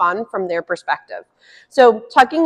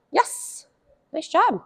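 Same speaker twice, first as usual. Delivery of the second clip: soft praise; 6 semitones higher, 7 dB quieter, slower than usual. Real, not acted. Praising dog softly.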